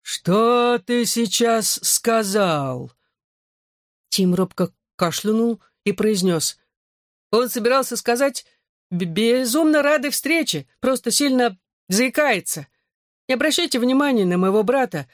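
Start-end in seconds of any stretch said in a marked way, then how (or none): 0.0-2.9 slow, higher menacing gargoyle; grinding stone gravel voice. A narrator's reading. 8.9-15.1 put-on higher, eager boy voice; nervous boy. A narrator's reading. Tim talks nervously.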